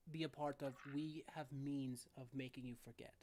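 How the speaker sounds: hushed grandfatherly reassurance; gentle grandfather. Acted. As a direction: speak softly like a caring grandfather leaning down. Loving grandfather NPC speaks gently.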